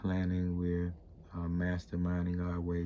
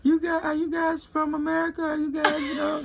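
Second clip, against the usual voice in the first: high, loud squeal of an excited stranger; excited local greeting; 7 dB louder than usual. Retold or imitated. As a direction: squeal it like a thrilled stranger spotting Americans. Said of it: "and they were like".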